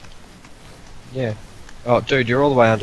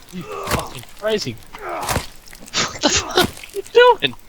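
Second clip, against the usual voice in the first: shrieking in panic; high, drawn-out panicked scream; panicking gamer; much slower than usual. Real, not acted. Frantic fight with a madman.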